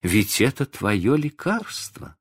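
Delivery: slow, high-pitched dismay; gentle reproach. A narrator's reading. Wife sniffing the dog's bowl, gently reproachful. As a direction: point it out slowly, disappointed.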